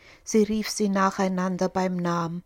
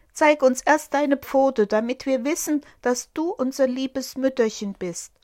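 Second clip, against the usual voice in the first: put-on higher, louder demanding kids; wary goat kids; 5 semitones higher, 4 dB louder than usual. Goat kids demand to see the paw, higher and loud. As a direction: insist like suspicious little kids.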